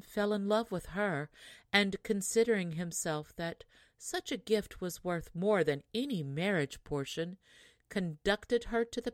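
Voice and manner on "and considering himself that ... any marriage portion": ordinary delivery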